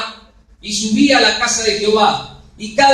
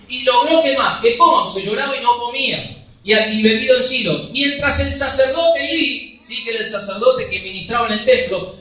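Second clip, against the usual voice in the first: normal talking voice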